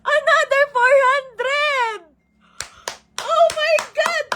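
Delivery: gasping, high-pitched astonishment; gasp, then cry out high in disbelief; shocked surprise. Real, not acted. Streamer reacting to a surprise gift of stars, astonished. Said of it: "nagulat ako".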